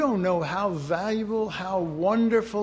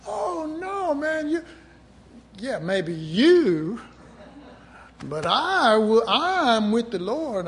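much higher, slow, loud boast; cocky young believer; 6 semitones higher, 4 dB louder, slower than usual. Retold or imitated. Mocking his younger self, full of himself. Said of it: "I was full of myself".

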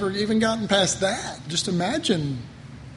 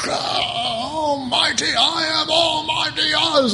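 slow, higher mock-booming wizard shout; 8 semitones higher than usual. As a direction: proclaim like the great and terrible Oz. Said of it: "remember the Wizard of Oz".

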